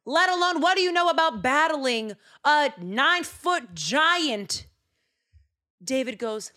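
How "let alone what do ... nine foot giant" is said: slow, loud, high disbelief; incredulous king. Acted. Saul doubting the shepherd boy, slow loud disbelief. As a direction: scold the boy for his nerve. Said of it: "Saul goes".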